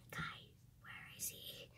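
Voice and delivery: hushed whisper while searching. Real, not acted. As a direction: breathe the words out. Seeker searching quietly.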